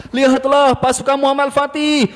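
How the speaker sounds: high, loud storyteller's proclamation; rally young listeners to see the army; children's storytelling. Shown as a demonstration. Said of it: "pakai namanya program Taman Kisah". (pendongeng anak berseru lantang)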